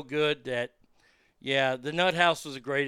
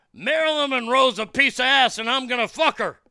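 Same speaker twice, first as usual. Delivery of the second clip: sudden loud, much higher bellow on waking; yelled outburst; 8 semitones higher, 8 dB louder than usual. Retold or imitated. Dentist waking in the lunchroom, yelling out loud and high. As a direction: jolt awake and holler it at the room. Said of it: "he would yell something".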